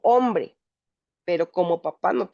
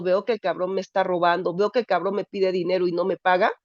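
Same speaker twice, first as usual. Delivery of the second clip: ordinary talk, nothing marked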